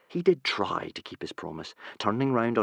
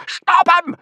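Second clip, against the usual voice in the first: shout; 10 dB louder than usual. Acted. Gobber yelling at Hiccup.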